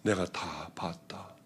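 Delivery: slow low whisper; slow solemn whisper of the Lord; hushed divine voice. Retold or imitated. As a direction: breathe it like a voice from heaven. Lord's voice heard in prayer.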